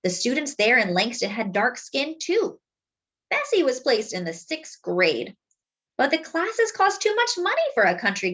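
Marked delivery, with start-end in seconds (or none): none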